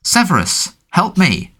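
high, cracking cry for help; cracked, terrified. A narrator's reading. Captive woman begging Snape for help, terrified cry. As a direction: plead in terror, voice breaking. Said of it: "in a cracked and terrified voice".

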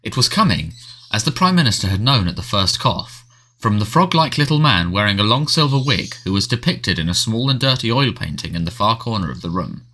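ordinary delivery

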